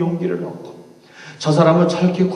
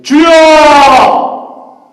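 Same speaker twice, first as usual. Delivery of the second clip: a long, much higher crying shout; anguished cry; 13 semitones higher, 15 dB louder, much slower than usual. Acted. Struck Paul crying out to the Lord, a long wailing shout. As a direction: wail the word out, stretched very long.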